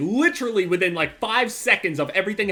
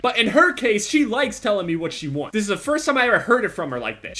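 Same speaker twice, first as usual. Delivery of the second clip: ordinary talk, nothing marked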